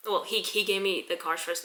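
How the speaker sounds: ordinary delivery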